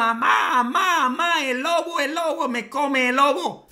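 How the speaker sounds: put-on boy's high, panicky cry for his mom; panicked boy. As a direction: scream that the wolf is eating him, high pitched. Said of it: "Y grita el niño".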